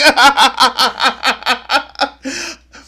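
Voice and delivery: big rising cackle of disbelief; howling laugh at the clip; loud high laugh. Real, not acted.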